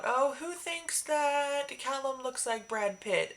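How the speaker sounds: teasing Michael. Retold or imitated. Michael teasing crowd about Callum.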